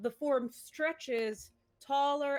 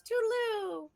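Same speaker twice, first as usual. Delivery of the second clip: much higher, sing-song goodbye; Cordial's farewell; 12 semitones higher, 4 dB louder than usual. Acted. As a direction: trill it off as she flutters away. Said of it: "Pretty good cordial".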